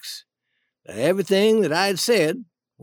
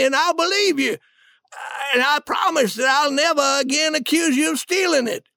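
higher-pitched, stammered disbelief at first; acted urgent plea; mildly distressed pleading; 7 semitones higher than usual. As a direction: appeal to him earnestly. King pleads while accused.

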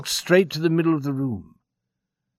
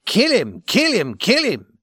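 loud, rising higher each time; mimicking the cowboy; 9 semitones higher, 4 dB louder than usual. A narrator's reading. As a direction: throw the cowboy's cry back at him. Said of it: "in the tone the cowboy had used".

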